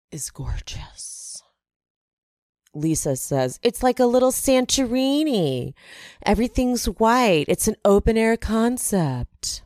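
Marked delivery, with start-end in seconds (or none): 0.1-1.2 hushed, slow admiration; awed whisper. Real, not acted. Awed gushing over the house. 3.6-9.6 higher-pitched gushing imitation; put-on high gush; imitated gushing housewife. Voicing Lisa gushing over the house.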